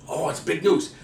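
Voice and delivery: ordinary talk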